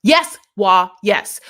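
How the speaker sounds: ordinary talk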